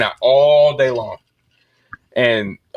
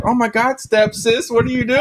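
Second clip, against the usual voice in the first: high mock surprise; 9 semitones higher than usual. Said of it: "and I was like".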